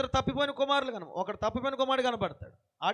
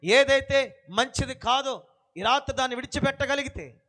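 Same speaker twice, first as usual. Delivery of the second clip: louder closing appeal; forceful preaching; 5 dB louder than usual. Acted. Preacher calling listeners to give up sin, forceful preaching. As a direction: drive the promise home to the church.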